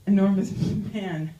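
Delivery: ordinary talk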